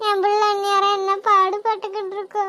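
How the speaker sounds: put-on a mother mourning her drowned child; grieving mother. Mother refuses to leave, weeping.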